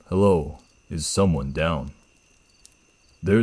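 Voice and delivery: slow, with "down?" falling so the question becomes a statement; flat eerie mimicry. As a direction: slowly copy the question, its end falling flat. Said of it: "It ended on a downward inflection".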